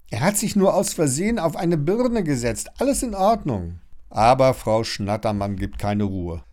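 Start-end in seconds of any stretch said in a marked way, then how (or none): 0.1-3.8 higher-pitched explaining; smooth it over with relief; mildly relieved reassurance. A narrator's reading. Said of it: "ruft Lilly erleichtert".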